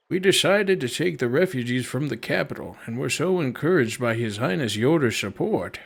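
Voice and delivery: put-on old man voice. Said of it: "not really hard for me to do an old man voice".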